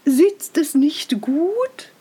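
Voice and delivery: slow, preening emperor asking for praise; vain emperor. Acted. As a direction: savour each word like a vain king. Emperor preens before the mirror.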